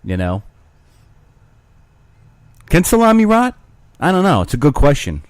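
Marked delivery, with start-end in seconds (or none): 2.7-5.2 mock radio host.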